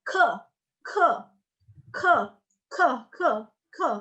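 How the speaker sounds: ke with a puff of air after the k; aspirated ke. Shown as a demonstration. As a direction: drill short ke syllables, each breathed out. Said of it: "itu ada aspirasinya".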